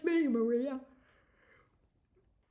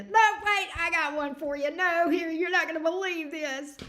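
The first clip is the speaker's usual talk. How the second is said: much higher and louder, tripping over herself to top the story; mock eager one-upping; 7 semitones higher, 7 dB louder than usual. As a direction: playfully burst in with the next cringe story. Mock excited one-upping contest quote.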